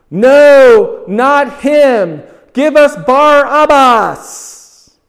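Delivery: the stirred-up crowd shouting at Pilate, loud. Acted. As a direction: roar it like an angry mob. Crowd demands Barabbas.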